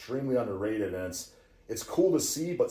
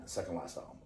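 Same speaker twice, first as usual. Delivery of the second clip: usual speaking voice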